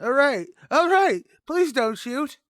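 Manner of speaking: very high, loud frantic begging; terrified pleading. Acted. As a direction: plead desperately not to be shot. Suspect pleads at gunpoint.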